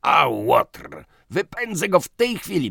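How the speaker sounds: loud, high, furious exclamation; indignant outburst. Acted. Piotrowicz rages about the swindling canvasser, indignant. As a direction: explode with outrage at the rascal.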